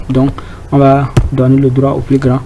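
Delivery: ordinary talk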